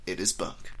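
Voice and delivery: host quietly corrects his own mistake; muttered correction. Acted. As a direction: mumble the fix under your breath.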